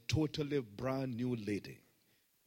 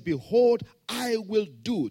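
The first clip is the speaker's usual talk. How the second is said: higher, louder scripture quote; preaching; 8 semitones higher, 10 dB louder, slower than usual. Acted. Pastor quoting the verse to the congregation, raised. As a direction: lift the verse up.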